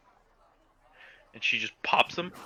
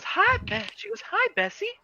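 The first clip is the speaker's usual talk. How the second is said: very high, bright greeting voice; chirpy shopkeeper. Acted. Shopkeeper Nora greeting the party, very high cheerful voice. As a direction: squeak a cheerful hello way up high.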